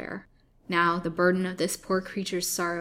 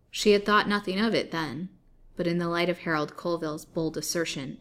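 usual speaking voice

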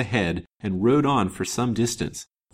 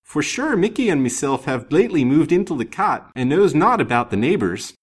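high, loud broad Irish brogue; Irish countrywoman's brogue, raised and loud; broad Irish accent; 6 semitones higher, 6 dB louder than usual. A narrator's reading. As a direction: lilt it like an Irish cottager. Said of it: "with a very broad Hibernian accent".

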